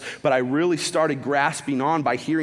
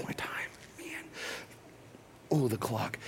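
quiet, low note to self; muttered aside; 5 semitones lower, 14 dB quieter than usual. Real, not acted. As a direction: break off and mumble about the time. Aside to himself about time.

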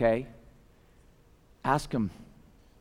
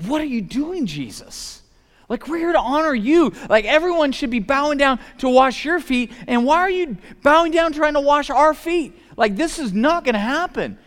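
high, loud, exasperated protest; annoyed Peter; 13 semitones higher, 6 dB louder than usual. Retold or imitated. Peter protesting to Jesus about the foot washing, high and incredulous. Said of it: "And Peter is just annoyed".

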